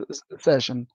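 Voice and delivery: ordinary talk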